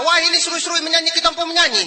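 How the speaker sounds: high, loud indignant ranting; acted husband's irate outburst; angry complaint. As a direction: complain heatedly at full pitch. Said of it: "bapak kenapa marah-marah".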